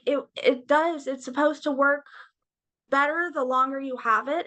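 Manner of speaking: ordinary talk, nothing marked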